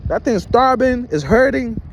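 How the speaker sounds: usual speaking voice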